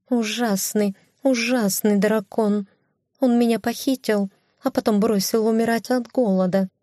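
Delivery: high indignant cat voice. Acted. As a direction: complain high like a wronged cat. (высокий возмущённый кошачий голос)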